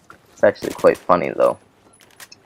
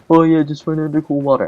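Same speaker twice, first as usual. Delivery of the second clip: high, fast, airy mock voice; mocking babysitter; 8 semitones higher, faster than usual. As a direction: mimic her clueless advice.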